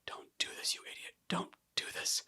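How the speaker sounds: whispered self-scolding; hushed, urgent inner voice; fighting with oneself. Retold or imitated. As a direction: scold yourself under your breath. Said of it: "I'm just fighting with myself".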